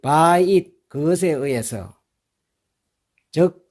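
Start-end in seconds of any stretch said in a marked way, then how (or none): 0.0-0.7 very slow, each word laid out for learners; slow model reading. Shown as a demonstration. Teacher to students, very slow model reading. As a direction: read it out slowly for students to copy. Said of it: "이렇게 하면 되겠죠".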